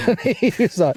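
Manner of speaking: high laugh breaking into the words. Real, not acted. Telling mates a funny story, laughing high. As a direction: chuckle through the lead-in.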